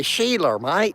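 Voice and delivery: high Aussie "Sheila, mate"; mock Australian. Vlogger quotes Australians calling a girl, mock accent. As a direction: tease the Aussie slang. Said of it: "they say".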